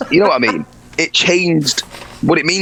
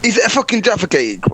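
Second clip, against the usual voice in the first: exasperated; 8 semitones higher than usual. Real, not acted. Exasperated shouting at screen.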